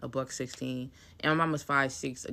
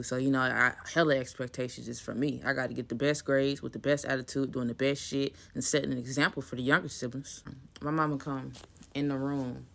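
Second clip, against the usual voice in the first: ordinary talk, nothing marked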